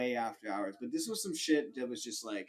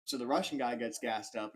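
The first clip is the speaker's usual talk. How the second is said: usual speaking voice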